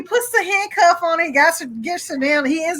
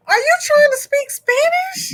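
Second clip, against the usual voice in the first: much higher, disbelieving question; incredulous cop; 12 semitones higher than usual. Retold or imitated. Cop hears the woman switch to Spanish, incredulous. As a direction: let the disbelief shoot the voice way up.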